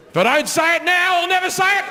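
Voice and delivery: very high, hammed-up urgency; desperate mock heckler. Hater after show after show, hammed-up desperation. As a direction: push it high, as if it's now or never. Imagined hater finally speaking up.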